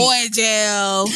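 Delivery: drawn-out words through laughter; laughing. Real, not acted. Teasing co-host about jail, laughing. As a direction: stretch it out while cracking up.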